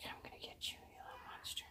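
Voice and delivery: sneaky whisper of a hunting cat; whispering the stalking cat's thoughts; whispered cat threat. Retold or imitated. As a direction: hiss it like a cat stalking prey. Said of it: "She's like".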